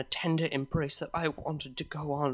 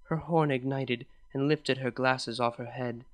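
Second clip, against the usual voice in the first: plain reading voice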